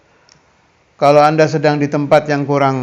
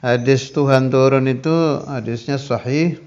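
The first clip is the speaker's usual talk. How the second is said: ordinary talk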